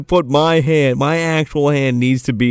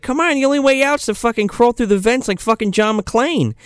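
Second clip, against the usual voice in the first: much higher eager voice; lightly hammed teen girl rallying her friend; mock bold plan; 10 semitones higher than usual. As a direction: pitch the plan with excitement. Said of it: "She's like".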